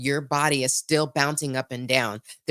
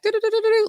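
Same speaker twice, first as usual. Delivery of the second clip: high rapid do-do-do chatter; scattered brain noise; much faster than usual. Retold or imitated. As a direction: mimic a racing mind, much higher. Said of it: "those of us whose brains are like".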